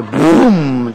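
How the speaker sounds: booming vocal blast; imitated gunshot. Husband firing from under the pillow, imitated bang. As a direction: make a sudden shotgun boom.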